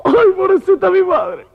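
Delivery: hammed-up wailing, extremely high; shrieking mock sobs and long squealed cries; crying. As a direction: wail slowly like a comic mourner. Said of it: "la mariquita llorando".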